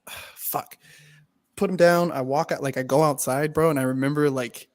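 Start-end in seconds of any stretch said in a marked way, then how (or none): 0.1-0.8 1 whispered curse. Retold or imitated. Retold dismay at friend dragged out.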